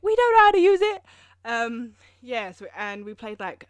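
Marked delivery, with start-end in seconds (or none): 0.0-1.0 mock exasperated squeal. Joking about Excel users.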